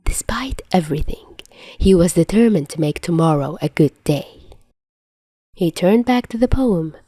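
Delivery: plain reading voice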